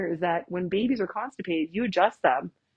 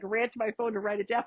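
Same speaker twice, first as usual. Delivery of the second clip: ordinary delivery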